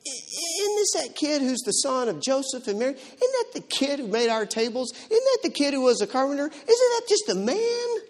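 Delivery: high-pitched mock disbelief, stretching the last question; incredulous townsfolk. Townsfolk who know the carpenter's kid question him, incredulous.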